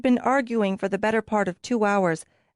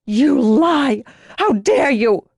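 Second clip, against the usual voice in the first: loud, high, furious accusation; shouted charge of lying; accusing outburst; 5 semitones higher, 5 dB louder, much slower than usual. Acted. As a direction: hurl it at him, outraged. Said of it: "glanced ... accusingly".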